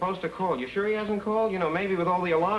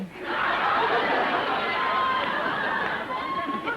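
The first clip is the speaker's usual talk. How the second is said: laughing. Acted. Laughs on leaving.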